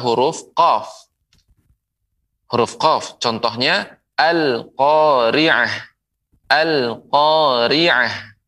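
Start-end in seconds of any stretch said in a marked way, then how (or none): 3.2-8.3 very slow "al-qari'ah" with the l said clearly; clear al pronounced. Shown as a demonstration. Teacher modelling "al-qari'ah" slowly to viewers. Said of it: "Dibaca dengan jelas".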